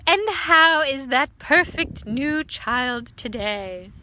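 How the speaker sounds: loud, slow sweet enquiry; a smug neighbour asking; slow loud fussy voice; proud neighbour. A narrator's reading. Missus Shin greets neighbour.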